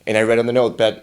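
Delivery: ordinary talk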